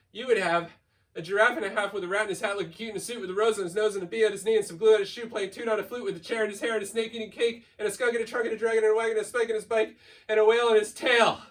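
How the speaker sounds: ordinary delivery, nothing marked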